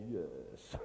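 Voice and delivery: low, soft Bond villain purr; mock villain. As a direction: purr it like a villain stroking a cat.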